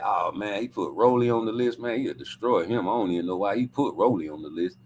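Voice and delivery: low, hushed pleading voice; imagined pitch to Bill. Pretend. Him talking Bill out of Rolly, low and coaxing. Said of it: "Go through the list and be like".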